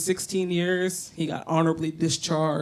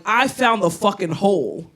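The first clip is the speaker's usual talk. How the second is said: smug gloating; 3 semitones higher, 7 dB louder, slower than usual. Retold or imitated. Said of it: "I'm like".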